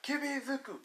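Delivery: high-pitched acted urgency; urgent demand. Friend demanding the injured one.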